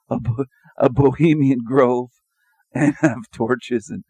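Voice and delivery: giggling through the words; laughing. Real, not acted. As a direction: laugh at the joke as you say it. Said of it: "I'm laughing".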